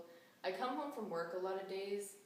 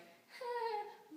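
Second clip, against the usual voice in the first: short high squeal, mocking own voice; high-pitched voice demo. Shown as a demonstration. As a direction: demonstrate a very high-pitched "hah". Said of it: "that high-pitched kinda, like".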